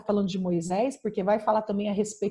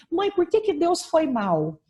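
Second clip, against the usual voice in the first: put-on higher and slow, hurt child's question; daughter's tearful question, voiced by her mother; imitating tearful daughter; 6 semitones higher, 4 dB louder, slower than usual. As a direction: ask it like a wounded little girl. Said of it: "Ela falou assim".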